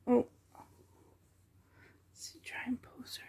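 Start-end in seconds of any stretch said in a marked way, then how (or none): 2.1-3.2 murmured under the breath; hushed whisper. Real, not acted. Posing the doll for the camera, whispered. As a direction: whisper to yourself while posing her.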